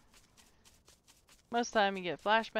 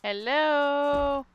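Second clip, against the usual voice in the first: loud, high teasing sing-song; mocking call; 5 semitones higher, 6 dB louder, much slower than usual. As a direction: call out to him like a playground taunt. Mocking the monster from afar.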